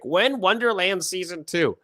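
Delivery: high, slow mock-whiny fan voice; squeaky, drawn-out imitation of an impatient fan. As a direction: ham up a pushy fan demanding news. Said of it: "many of you are wondering".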